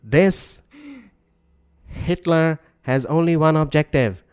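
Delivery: slow, grand mock dictator accent; Robert Mugabe. Co-host re-enacts the dictator's speech, pompous dictator accent. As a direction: declaim in a dictator accent, stretching each phrase. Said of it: "it's just my regular dictator accent".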